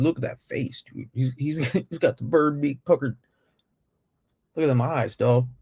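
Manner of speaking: ordinary delivery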